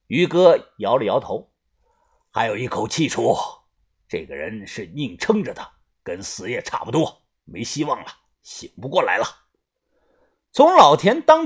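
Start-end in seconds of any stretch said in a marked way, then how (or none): 2.3-9.2 low, soft, grave verdict; grim low voice. Acted. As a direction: say it low and resigned. Grim verdict on dying man.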